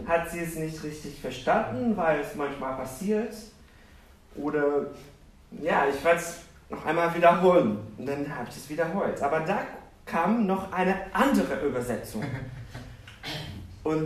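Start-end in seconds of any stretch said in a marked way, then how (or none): none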